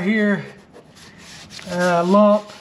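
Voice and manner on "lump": ordinary talk, nothing marked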